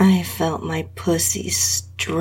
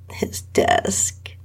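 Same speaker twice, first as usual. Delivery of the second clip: ordinary delivery, nothing marked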